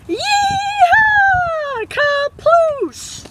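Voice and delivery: very high whooping yell; shouting. Acted. Kids yelling as the canoe plunges down the waterfall. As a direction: whoop it down the falls. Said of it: "called out".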